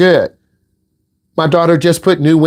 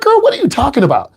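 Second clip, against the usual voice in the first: higher, quick, baffled retort; incredulous dad; 8 semitones higher, faster than usual. Retold or imitated. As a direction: fire back in mock disbelief. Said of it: "I'm like".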